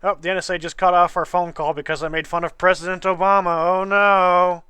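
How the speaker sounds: loud, hammed-up dismay; playing up fake alarm; mock alarm. Joking about dropped call.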